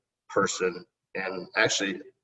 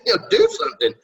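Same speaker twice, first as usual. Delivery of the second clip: high-pitched laughing plea to the son; 5 dB louder than usual. Retold or imitated. Dad laughing as he tells his son to shower. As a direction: laugh it out, half begging. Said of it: "I'm like".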